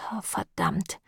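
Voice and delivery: whispered oath; soft, breathed-out swearing; hushed curse. A narrator's reading. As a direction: curse under your breath, almost voiceless. Swearing under breath after the scuffle.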